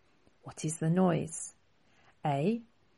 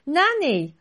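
high, louder shocked cry; gasped; 11 semitones higher, 5 dB louder than usual. Acted. Boy finding his tied-up grandmother, shocked gasp. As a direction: gasp out her name in shock. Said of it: "Rocky gasped".

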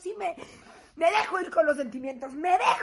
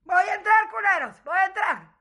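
louder, higher shouted warning; mock shouting; 6 semitones higher, 9 dB louder than usual. Wife shouting at players to cover up, loudly. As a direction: yell it through the door. Calling into locker room.

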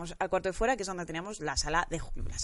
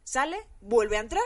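ordinary talk

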